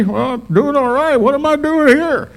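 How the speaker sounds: put-on high, loud, puzzled old man; Keith, bewildered. Keith answering the pastor from his drifting boat, high and loud. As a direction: sound lost but cheerful.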